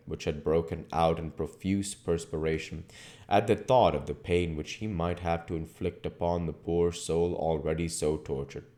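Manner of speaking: ordinary delivery, nothing marked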